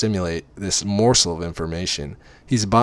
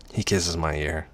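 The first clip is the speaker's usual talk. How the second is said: plain reading voice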